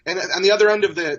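ordinary delivery

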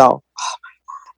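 soft, slow "oh my God"; quietly overwhelmed, as remembered; retold stunned reaction. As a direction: drop to a hushed, drawn-out murmur of dread.

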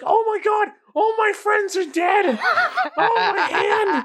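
very high wailing goblin; panicked goblin. Acted. Game master as wounded goblin cursing, high panicked wail. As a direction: shriek it in a frantic squeaky voice. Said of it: "he's currently cursing a lot".

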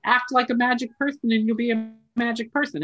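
high, fast, breezy mock cheer; sing-song mockery of easy magic talk; mock cheerful advice. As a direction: parody the sunny self-help pitch, quick and bright. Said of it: "so it sounds great. Like".

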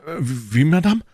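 high, rising startled question; surprised cry. A narrator's reading. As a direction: exclaim it, swooping up in surprise. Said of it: "rief Porthos". (überrascht ausgerufen, hoch ansteigend)

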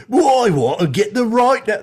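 high, loud fake Cockney; a really shitty Cockney, on purpose; bad Cockney accent. Mocking Scorponok's Cockney to the co-host, loud and high. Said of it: "Scorponok has a Cockney accent".

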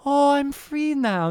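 lightly hammed-up relief, drawn out; mock relieved dad. Podcaster mimics the freed dad, mock blissful.

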